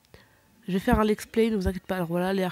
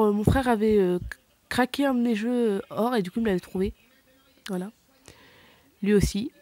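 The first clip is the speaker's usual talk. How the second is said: ordinary talk, nothing marked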